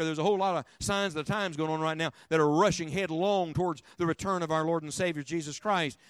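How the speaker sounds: normal talking voice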